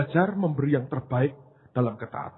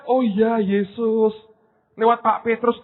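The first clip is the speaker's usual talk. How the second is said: mock congregant; 9 semitones higher, 7 dB louder, slower than usual. Imitating a congregant woman.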